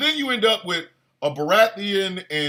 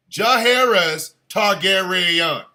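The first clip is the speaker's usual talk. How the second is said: "Jaehaerys Targaryen" slowly, every syllable stretched; drawn-out name; 4 dB louder, slower than usual. Shown as a demonstration. Savouring the long name slowly.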